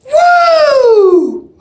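high loud whoop with a laugh in it; cheer shout. Real, not acted. Fan retaking his cheer, loud laughing whoop=39. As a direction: whoop again, bigger. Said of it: "That was number four".

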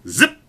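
sudden high, loud zip; arrow zip. A narrator's reading. Storyteller as the yeoman looses his arrow, sudden zip. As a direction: fire the word off like an arrow. Arrow sound acted out in narration.

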